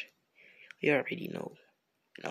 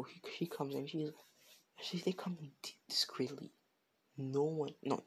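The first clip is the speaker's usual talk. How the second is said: ordinary delivery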